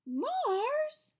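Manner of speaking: put-on high, stunned kids' gasp; the whole class gasps at once; gasping in shock. A narrator's reading. Children shocked at their teacher's news, gasping high. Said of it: "the children gasped".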